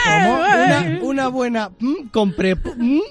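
high, teasing stammer imitation; mock stammer. Se burla del tartamudeo. (imitación burlona del tartamudeo, aguda)

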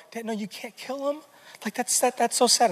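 put-on pleading little girl. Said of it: "Brooklyn's like".

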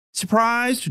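high Texas twang quip; quipping. A narrator's reading. Dorothy quips back at her captain, high twang. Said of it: "Dorothy quipped".

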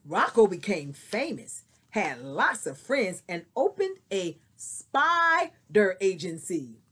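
expressive storybook reading; story narration. Acted. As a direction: read it like a picture book. Teacher reads story aloud.

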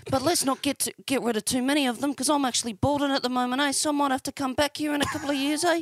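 high, chatty Kiwi soldier caricature; New Zealand accent. Acted. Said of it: "He was from Auckland".

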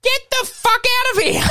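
high, shocked disbelief; incredulous. Real, not acted. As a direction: squeal it in disbelief. Incredulous squeal.

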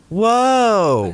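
high, sliding mock "whoa"; mock surprise. Mock shock at the reunion.

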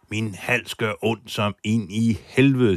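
ordinary delivery

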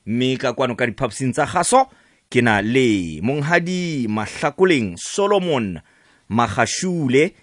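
ordinary delivery

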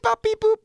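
high chirpy beep-boop imitation; microwave beeps. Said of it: "I'm hearing you beep".

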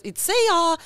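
high silly voice of reason running away; reasoning fleeing. Retold or imitated. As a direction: play your reason bailing out in a panic. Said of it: "to be like".

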